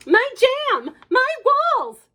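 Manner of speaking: high, loud outraged cry; mother shouting at the wolves' mess. Acted. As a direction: wail it in dismay. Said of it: "shouted Mother".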